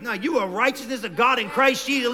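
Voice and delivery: normal talking voice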